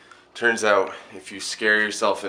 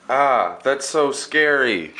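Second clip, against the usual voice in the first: scared; 8 semitones higher, 11 dB louder, slower than usual. Acted. Frightened by his own prank.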